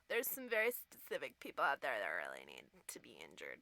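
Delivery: hushed, low joking threat; playful creepy whisper; mock sinister whisper. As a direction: whisper it like a dark secret. Dark joke aside.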